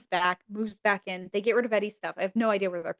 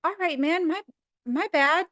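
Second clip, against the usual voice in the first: high, resigned, casual goodbye; mock sheepish Eddie; 9 semitones higher than usual. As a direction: back off lightly, a bit high. Eddie gives up at door.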